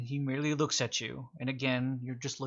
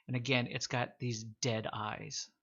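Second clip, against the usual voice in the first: ordinary talk, nothing marked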